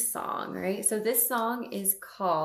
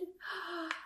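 soft gasp; 10 semitones higher, 11 dB quieter than usual. Acted. Child-show wonder at eyes.

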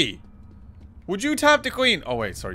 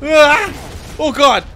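loud distress; 5 semitones higher, 5 dB louder than usual. Real, not acted. Overwhelmed in fight.

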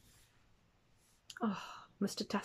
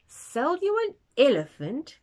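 high, loud disbelieving cry; loud astonished outburst; 8 semitones higher, 8 dB louder than usual. A narrator's reading. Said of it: "cried the safari park manager".

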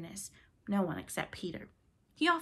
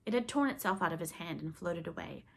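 plain reading voice

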